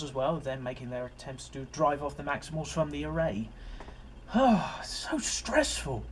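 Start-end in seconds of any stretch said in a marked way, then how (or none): 3.5-4.8 exasperated sigh. Real, not acted. Exasperated sigh about the episode. 4.8-6.0 mock exasperation. Real, not acted. Mock stressed after sigh.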